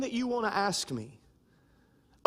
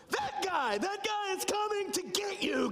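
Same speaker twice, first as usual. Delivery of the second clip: mom's warning; 9 semitones higher than usual. Retold or imitated. Mom warns the kids.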